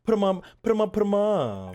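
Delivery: high, loud mock boxer challenge; mock tough guy. Streamer joking at the threat, hammed-up loud challenge. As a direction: playfully shout like a brawler.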